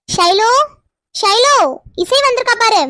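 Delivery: calling out. Acted. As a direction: call out to fetch someone. Calling someone from the house. (கூப்பிடும் குரல்)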